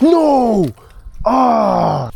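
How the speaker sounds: high, loud cry of dismay; anguished shout. Real, not acted. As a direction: yell as the fish escapes. Fish gets away.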